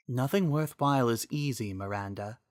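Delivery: a wise spirit speaking kindly; the Triforce of Wisdom thanking Miranda, gentle and soft; soft female voice. Acted.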